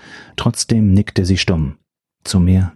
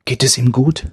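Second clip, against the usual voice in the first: anxious mother; 5 semitones higher than usual. Acted. Mother asks anxiously for son.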